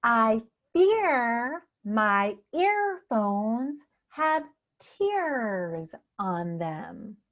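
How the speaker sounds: very slow, stretching the ear in "fear", "earphones", "tears"; slow tongue twister. Shown as a demonstration. Coach modelling a new tongue twister for you, very slow. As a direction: draw out each word, lingering on the ear sounds. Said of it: "created a new tongue twister".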